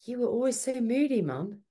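high-pitched complaining child, mimicked; imitated kid's high-voiced reproach; grown-up kids. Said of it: "our kids will go".

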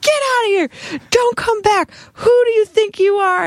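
high, furious street yell; re-enacted high-pitched tirade; retold mad yelling. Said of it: "I was so mad".

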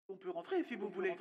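very soft, high, fast echo of a timid voice; distant repeating echo, high and faint. Acted. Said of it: "Un écho s'empara de ces derniers mots". (écho lointain et aigu)

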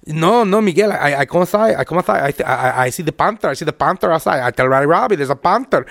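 imitated Puerto Rican accent, repetitive and halting; stammering impression in a Puerto Rican accent; Harry. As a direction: mimic a Puerto Rican man's accented English. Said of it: "this is what Harry goes".

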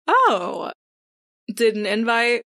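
pointedly curious, opening on a high "oh". Acted. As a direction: feign polite curiosity at an unexpected guest. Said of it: "kind of curiously".

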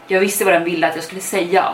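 usual speaking voice

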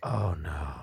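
low mock groan of dread. Recruit groaning at the coming test, mock dismay. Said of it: "You hear a bunch of groans from the men".

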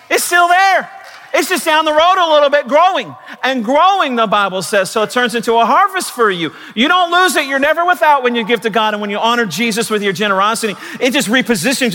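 high-pitched rousing sermon outburst; fervent preaching. Real, not acted. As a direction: cry out the promise to the congregation. Preaches at full force.

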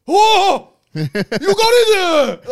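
hammed-up, very high drawn-out holler; imitated delighted yelling. Japanese teacher cheering his pupil on, mock yell. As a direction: yell it slowly like an over-the-top proud teacher. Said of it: "he used to yell at me".

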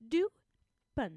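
Deux Punx with the final X dropped; silent X. Shown as a demonstration. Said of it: "drop that final consonant".

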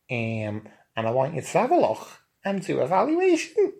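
put-on camp Liverpool accent. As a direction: fuss over his roots in camp Liverpool. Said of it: "in his camp Liverpool accent".

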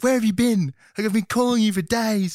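high, loud worried wife; mock frantic girlfriend; frantic Layla. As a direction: plead as a wife who lost him for days. Retelling Layla's frantic call.

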